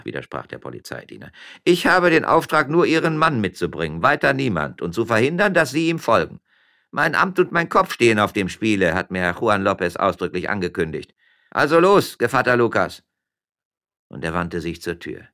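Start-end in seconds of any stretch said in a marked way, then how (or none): 1.6-13.0 loud, high-pitched official; commanding policeman. Acted. Policeman takes only the husband along, commanding. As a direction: insist forcefully on the orders. Policeman insists on his orders.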